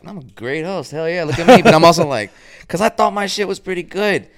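high, loud, boastful self-praise; mock cocky. Brushing off the teacher, a high mock-cocky boast. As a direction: hype yourself up, half laughing. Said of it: "I kinda was like".

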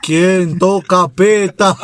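very slow, drawn-out words; hammed-up echo of a call at the door; mock door call.

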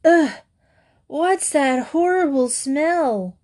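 put-on high, slow disgusted kid voice; childish disgust at dinner. A narrator's reading. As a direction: wrinkle the nose and drag it out. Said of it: "Will said in disgust".

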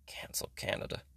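breathy voiceless whisper of the slogan. Acted. To the Cancel Canada crowd, an eerie whisper. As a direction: hiss it like a secret.

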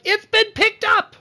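high, loud squeal of joy; all caps excitement. Retold or imitated. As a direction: shout it like a fan who just heard the news. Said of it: "all caps messages on Twitter".

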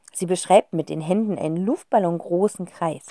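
plain reading voice

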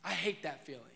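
normal talking voice

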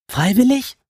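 high, scornful disbelief; contemptuous. Acted. Alice mocking the goblin's claim, scornful and high. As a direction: sneer the word at him. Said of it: "fragte Alice so verächtlich, wie sie konnte".

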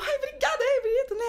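high, giggly mock thank-you; gushing thanks. Candidate thanking the interviewer for a compliment, gushing.

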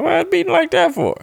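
high alarmed squeak; alarmed question. Retold or imitated. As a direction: panicky high-pitched question. Panicked self-quote at night.

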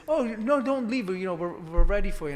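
high, loud pleading call after him; eager receptionist. Retold or imitated. Receptionist calling after a leaving visitor, high and pleading. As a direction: stop him at the door, bright and urgent. Lady urging him not to leave.

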